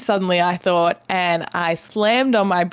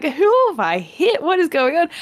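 high-pitched, incredulous retold questions; mock bewilderment; 8 semitones higher than usual. Retelling the clown car pile-out, mock disbelief. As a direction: exclaim it high, imitating total disbelief. Retold shock as passengers keep appearing.

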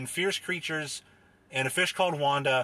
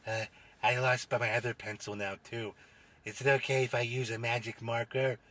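lightly voiced low oddball with a snicker; creepy panelist character; 5 semitones lower, 3 dB quieter than usual. Panelist asks host about marker, sly low character voice. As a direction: ask slyly in a low character voice.

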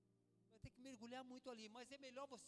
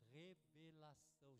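"revelação" slowly, syllable by syllable, softer; emphatic spelling-out; 4 semitones lower, 7 dB quieter, slower than usual. Shown as a demonstration. Preacher repeating the first word, "revelação" slow and measured. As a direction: draw out each syllable of "revelação" lower. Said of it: "A primeira palavra qual é?".

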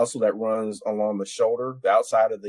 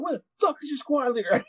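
high-pitched mock sergeant demand; high, outraged sergeant impression; angry first sergeant. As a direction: imitate an irate sergeant, voice pitched way up.

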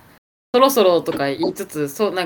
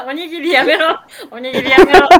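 mock scolding; 5 semitones higher, 8 dB louder than usual. Real, not acted. Scolding co-host's gesture.

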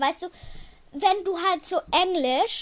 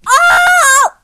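loud, high startled cry; startled shout; 17 dB louder than usual. Real, not acted. Host crying out in shock to her friend. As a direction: jolt as if caught off guard.

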